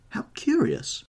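very high, lilting lady's voice; high-pitched surprised remark from a duchess; curious duchess. Acted. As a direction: exclaim it high, intrigued.

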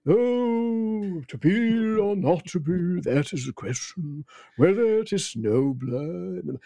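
slow, rhythmical Gielgud Shakespeare delivery; John Gielgud. Retold or imitated. Impressionist showing Gielgud's rhythmical Hamlet. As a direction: intone it slowly and lyrically like Gielgud. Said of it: "John Gielgud is".

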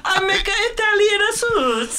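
very high, slow mock Italian accent; hammed-up singsong Italian accent, stretched out. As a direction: wail it like a cartoon Italian. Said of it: "and [name] is like".

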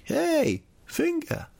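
high-pitched teasing hello; mock greeting. Greeting an old friend by his nickname, high teasing call.

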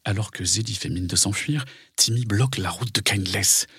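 plain reading voice

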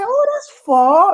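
high, slow, drawn-out mum sigh; mum's voice. Retold or imitated. Mum hearing the new ground is in Tooting, slow high voice. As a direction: stretch it out like a surprised mum.

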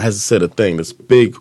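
normal talking voice

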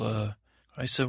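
plain reading voice

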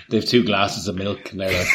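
ordinary talk

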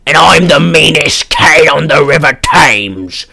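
put-on Australian tough-cat voice; amateur Australian accent, hammed up. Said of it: "what's his accent? Australian?".

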